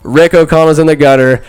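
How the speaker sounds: ordinary talk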